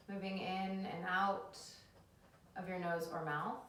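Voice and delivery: calm them down into the breath; soothing yoga teacher. Acted.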